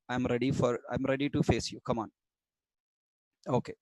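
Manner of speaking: usual speaking voice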